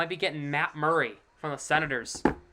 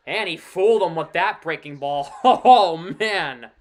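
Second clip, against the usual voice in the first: excited laughing call; 2 semitones higher, 11 dB louder, slower than usual. Real, not acted.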